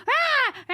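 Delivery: high mock baby squawk; short high yelps mimicking a baby; imitated baby yell. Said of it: "she thinks she's yelling".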